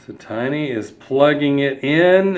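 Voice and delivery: ordinary delivery, nothing marked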